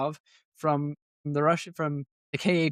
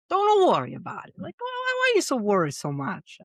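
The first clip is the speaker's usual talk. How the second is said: put-on high, hammy Italian accent; 10 semitones higher than usual. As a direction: go full Italian pizza chef. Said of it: "my Italian accent".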